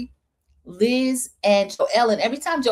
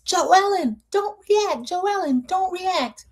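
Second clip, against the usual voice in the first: much higher mock yell; imitating a yell; 11 semitones higher, slower than usual. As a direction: call out urgently, repeated. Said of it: "Alicia at one point was yelling".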